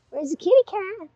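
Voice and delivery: high kitty call; cat call. Real, not acted. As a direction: sing out to find the kitty. Calling to shop cat.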